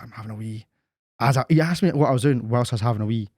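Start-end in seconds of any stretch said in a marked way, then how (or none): 0.0-0.6 hushed and embarrassed; quiet mock-sheepish reply; mumbled sheepish answer. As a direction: mutter it like you're caught out.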